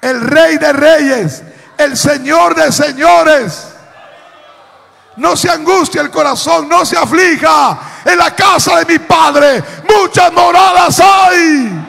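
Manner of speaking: rising, fervent preacher's cry; predicación a plena voz. Real, not acted. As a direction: proclaim the promise to the whole church. (proclamando con fervor)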